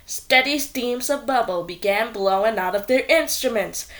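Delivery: plain reading voice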